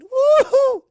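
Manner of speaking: joyful whoop. Acted. Celebrating new friend.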